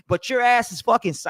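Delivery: ordinary talk, nothing marked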